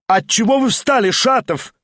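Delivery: surprised hostess calling. A narrator's reading. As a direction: call after him across the room. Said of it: "крикнула хозяйка".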